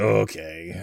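drawn-out, low okay; retold reluctant okay. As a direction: replay your own doubtful okay. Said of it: "yeah, that's exactly. I was like".

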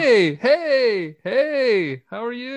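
high-pitched, sing-out mock hello; mock cheery greeting. Acting out small talk on a family Zoom, mock cheery greeting.

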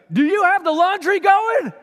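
loud high shout across the house; shouting through the house to his wife, loud and high. Retold or imitated. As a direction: yell it from the shower to the other room. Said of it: "I yelled at my wife".

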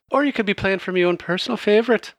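high, quick showy female voice; Kalora speaking. Acted. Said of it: "And then she says".

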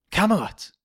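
high-pitched, awed cry; awed exclamation. Acted. Film gag quoted in three takes.

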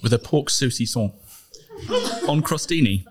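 mock-grand dish presentation, paused between phrases; MasterChef voice. Co-host finishing the fancy menu for tinned food, mock MasterChef voice. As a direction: announce each component like a MasterChef narrator. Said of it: "do it in the MasterChef voice".

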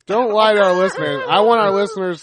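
loud, high mock scolding; mock outrage. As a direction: pretend to be outraged at him.

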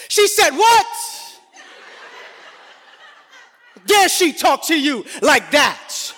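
high, shrill mock-indignant shout; husband overdoing his outrage for his wife, high and shouting; overblown outrage. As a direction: ham up outrage on her behalf. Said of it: "in a disproportionate way".

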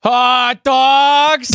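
high, loud New York vendor yell; stadium hot dog vendor call. Pretend. Asked to sell hot dogs, [name] yells a loud New York vendor call. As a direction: bellow it like a ballpark hawker. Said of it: "with a heavy, thick New York accent".

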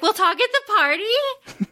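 high, eager disbelieving echo; delighted question. Retold or imitated. James to Cordelia, high delighted disbelieving echo. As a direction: jump on the words like a gift.

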